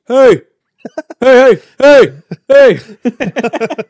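loud, high barked heys; barking dog voice. Retold or imitated. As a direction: bark each hey like an excited dog. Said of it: "the Brian Regan bit where every bark".

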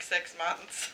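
ordinary talk

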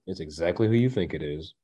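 low, soft matter-of-fact repeat; understated punchline. Real, not acted. Concluding there is no swerve to Angela, understated. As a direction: drop it down, quiet and final.

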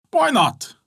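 high, quick yes from the smith; the smith readily agrees to go along. Acted. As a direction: toss it off lightly.

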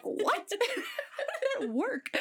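high, slow, laughing bafflement; amused shocked what; laughing disbelief. Retold or imitated. Stunned reaction retold.

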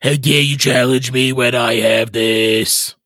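slow, menacing villain; evil voice. Acted. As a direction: taunt with scorn. Villain voice continues.

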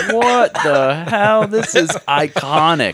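high, slow stretched what the hell; mock amazement. Opening the holiday card, high slow mock amazement. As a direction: marvel at it, dragging it out. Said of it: "And I was like".

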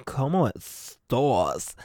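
low, very slow, stretched 'estás'; diva catchphrase. Shown as a demonstration. Host returning to topic after wine, slow diva drawl. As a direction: savor it low and long.

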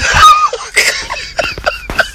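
very high squealing laughter; shrieking laugh. Real, not acted. Host losing it over a lyric, high squealing laugh. Cracking up at the song.

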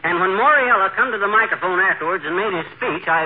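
ordinary talk

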